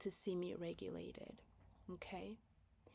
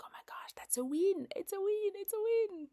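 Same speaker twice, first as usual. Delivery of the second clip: much higher, excited squeal of triumph; thrilled win; 12 semitones higher than usual. Retold or imitated. Mom thrilled her daughter went to bed: much higher excited squeal. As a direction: celebrate the little victory. Mom celebrating parenting success.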